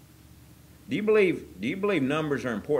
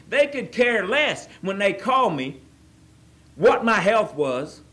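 higher, louder rising appeal; fervent preaching; 4 semitones higher, 4 dB louder than usual. Acted. Preacher questioning his old friends' care, loud and fervent. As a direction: press the question on them.

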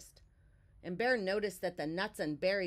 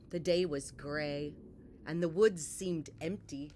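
ordinary delivery, nothing marked

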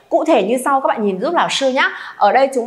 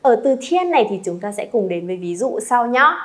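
ordinary talk

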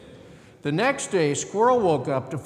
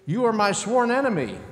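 sworn enemy; 6 semitones higher, 3 dB louder than usual. A narrator's reading. Rabbit's quarrel continues.

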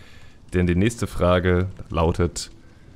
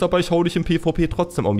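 higher, fast mock brag; mock gamer boast; 8 semitones higher, faster than usual. Player taunting a rich goldmaker, higher and fast. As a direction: toss off a cocky gamer taunt. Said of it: "du kannst auch sagen".

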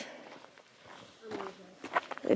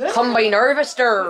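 rural farmer accent, louder; Farmer Joe; 6 dB louder than usual. Shown as a demonstration. Showing what a farmer sounds like, farmer accent. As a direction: put on a hammed-up farmer accent. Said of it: "Farmer Joe. It'd be like".

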